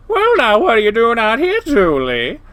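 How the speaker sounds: high, blaring coyote woman; loud, obnoxious Janice. A narrator's reading. Janice greets Julian from beside her car, loud and obnoxious. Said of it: "I can hear her loud, obnoxious vo".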